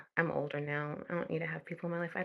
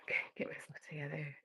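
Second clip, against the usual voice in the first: hushed self-calming; soft whisper, pulling herself together; whispered composure; 2 semitones lower, 6 dB quieter than usual. Real, not acted. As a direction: whisper to settle down.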